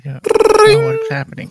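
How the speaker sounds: squeaky high panicked voice; panicky son. Acted. High squeaking panic. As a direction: son baffled by his nosebleed, squeaking high and loud.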